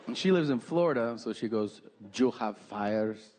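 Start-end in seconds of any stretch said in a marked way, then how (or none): 2.1-3.3 put-on Peruvian accent. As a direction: lay out the doom in a light Peruvian accent. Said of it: "she's from South America, Peru".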